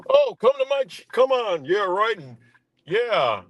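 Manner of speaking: high mock voice of the streamer; mocking Zach. Vlogger mocking rival streamer, high and sneering. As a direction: ham up a smug streamer begging viewers in.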